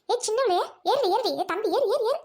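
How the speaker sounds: put-on high, hurried calls to children; urgent calling out. Mother hurries children onto the school van, calling out. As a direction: call the children aboard, pitch climbing.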